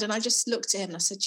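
usual speaking voice

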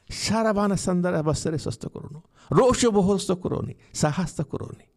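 high, forceful burst of prayer syllables; praying in tongues. Real, not acted. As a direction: break out in fervent glossolalia. Prays in tongues.

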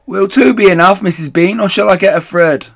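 loud, high question; calling up. Acted. The woman calls up about the jars, loud and high. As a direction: shout up for orders.

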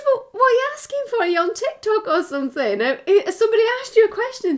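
high, fast flustered questions; fast, high flustered quizzing; flustered mum. Retold or imitated. Mum quizzing son, retold.

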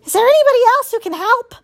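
high, fast, loud cry for help; panicked wounded animal calling out; howling in pain. A narrator's reading. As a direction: wail it out desperately. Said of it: "howling in pain".

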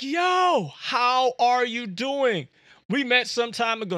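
eager, loud early-morning hello; too excited. Retold or imitated. Calling Joe at dawn, overly excited greeting. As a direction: gush over the phone like an old friend. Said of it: "They're too excited".

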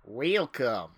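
badly faked Southern-sounding welcome; butchered Southern accent. Host's welcome after being told thick accent, butchered Southern. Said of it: "butchered Southern accent".

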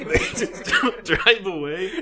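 high, slow words squeezed through laughter; really cracking up while talking; helpless laughter. Real, not acted. Cracking up at the story.

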